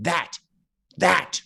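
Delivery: put-on high, loud, insistent toddler chant of that; a hungry baby pointing and demanding; imitating the toddler shouting for her steak.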